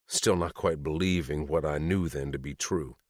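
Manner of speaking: usual speaking voice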